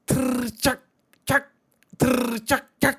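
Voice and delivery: loud trilled "thrr" with sharp clicks; high rolled trill and "chak" calls to the flock; herder's sheep call. A narrator's reading. Shepherd calls his sheep from the tree, trilled herding call. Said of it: "मेंढरांना उद्देशून आवाज केला".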